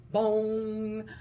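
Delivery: loud mock clock chime; clock bong. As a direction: ring out each bong. Clock striking twelve.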